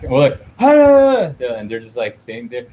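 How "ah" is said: sudden high yelled "ah"; mock yell. Yelling at someone who won't yell back. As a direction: blurt out a frustrated yell. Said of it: "when we yell at somebody".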